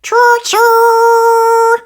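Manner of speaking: very high, loud choo-choo; a little boy playing train; shrill happy train call; toddler's cheer. A narrator's reading. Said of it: "cheered George".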